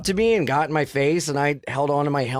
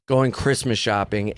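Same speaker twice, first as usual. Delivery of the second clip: ordinary talk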